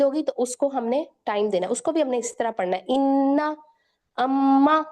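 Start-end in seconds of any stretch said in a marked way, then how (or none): 2.9-4.8 "انا" and "اما" with the doubled نون and میم held in the nose; nasal ghunnah. Shown as a demonstration. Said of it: "ناک میں لے جائیں گے".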